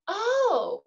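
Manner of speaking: high, loud dawning "oh"; sudden realization, voiced big. Coworker suddenly understanding the reason, a loud high oh. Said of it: "if people understand the why".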